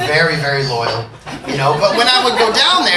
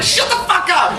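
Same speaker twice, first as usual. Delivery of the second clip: much higher mock snap; mock outburst; 10 semitones higher than usual. Teen snapping at girlfriend over the unfinished name. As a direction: brush her off in mock anger.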